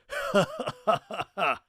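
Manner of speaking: high, loud guffaw of a guard; loud mocking laugh. Guard laughing out loud at Rat's knife. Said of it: "Seifraith laughed out loud".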